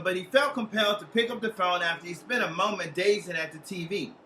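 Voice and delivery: ordinary delivery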